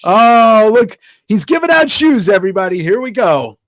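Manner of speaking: high, loud carnival barker; announcer. Pretend. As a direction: call it out to the crowd like a barker. Mock announcing a giveaway.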